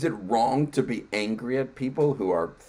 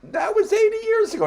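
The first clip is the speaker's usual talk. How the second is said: much higher, stressing was in disbelief; incredulous retort; 5 dB louder than usual. Retold or imitated. Answering the German guest in an incredulous squeak. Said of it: "And I'm like".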